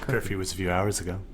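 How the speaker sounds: low, hushed, quick reproach; quiet stern parent. Acted. Mom catching her kid after curfew, low quiet reproach. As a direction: scold softly and low.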